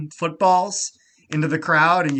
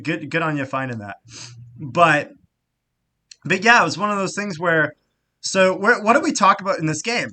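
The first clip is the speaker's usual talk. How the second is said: normal talking voice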